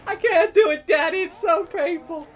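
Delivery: mock childish pleading with dad; pleading kid. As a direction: beg like a scared little boy. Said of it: "it's like".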